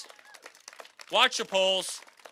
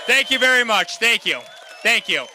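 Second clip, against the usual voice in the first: loud shouted thank-yous to the crowd; 3 semitones higher, 10 dB louder than usual. Real, not acted. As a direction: belt the thanks over a cheering hall. Closing rally thanks over crowd.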